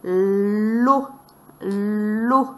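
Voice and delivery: "Lo" with a long held L; "Lo" sounded out slowly, L drawn out; isolated syllable. Shown as a demonstration. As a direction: stretch the L of "Lo" before the vowel. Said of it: "avec quelle syllabe".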